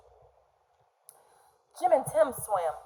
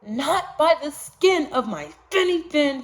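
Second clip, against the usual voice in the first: brave little fish; 7 semitones higher, 5 dB louder than usual. Acted. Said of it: "the brave little fish replied".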